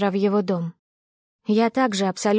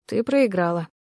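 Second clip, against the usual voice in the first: even tone. Acted. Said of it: "ровным тоном заключил он".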